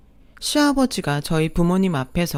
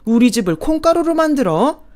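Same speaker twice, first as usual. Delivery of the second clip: put-on louder, bellowing old man rage; roaring father-in-law; 2 semitones higher, 5 dB louder than usual. Father-in-law roaring at his wife and son, enraged.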